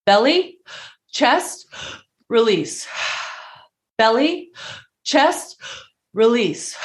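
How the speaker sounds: "Belly" and "chest" lifted high like questions, "release" falling; "Belly", "chest" up, "release" down, with audible breaths between; breathing-cue demo. Shown as a demonstration. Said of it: "give you a little demonstration".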